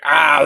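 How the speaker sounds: drawn-out imitated moan; mock groan. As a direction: groan mockingly like a constant complainer. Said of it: "just groaning and moaning".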